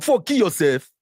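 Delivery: normal talking voice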